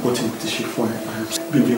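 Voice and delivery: ordinary delivery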